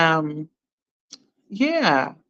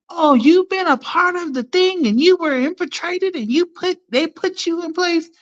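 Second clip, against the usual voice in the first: higher, lightly mocking voice; mocking that person; 10 semitones higher than usual. Imitating the accuser to her viewers, mock tone. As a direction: parrot the accuser, a bit high. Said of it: "that person says".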